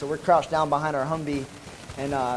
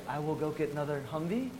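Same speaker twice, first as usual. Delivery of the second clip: soft-spoken; 7 dB quieter than usual. Retold or imitated. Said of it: "he speaks real soft".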